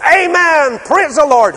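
high, loud ecstatic praise; joyful amen. Retold or imitated. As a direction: cry it out like a fervent worshipper.

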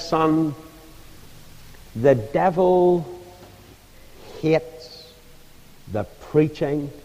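slow, soft, halting imitation of an ailing old woman; old lady's wheezing voice. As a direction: eke the words out weakly, a few at a time. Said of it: "that old lady with her wheezing voice".

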